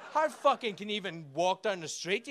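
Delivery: ordinary talk, nothing marked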